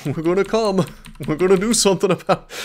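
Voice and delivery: mock British threat, hammed up; terrible British accent. Streamer playing licence enforcer, laughing, bad British accent. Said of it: "my terrible British accent".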